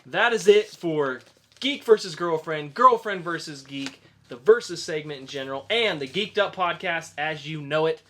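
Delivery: upbeat host sign-off; podcast outro. Acted. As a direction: wrap up the show for the audience.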